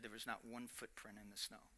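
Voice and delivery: very soft, hushed, letting it land; hushed reveal. Acted. Preacher revealing the miracle, hushed. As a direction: whisper the wonder.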